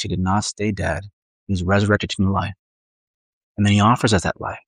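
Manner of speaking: ordinary delivery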